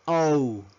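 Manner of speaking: letter O pronounced "ou"; English letter O. Shown as a demonstration. Teacher modelling the English alphabet, O as "ou". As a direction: glide the O into "oh-oo". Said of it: "La letra O".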